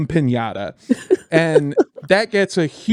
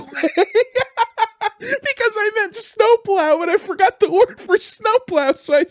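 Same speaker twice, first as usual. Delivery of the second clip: high, squealing laugh through the words. Real, not acted. Comic admitting a botched word to friends, laughing. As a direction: break up laughing as you explain. Laughing at own slip mid-story.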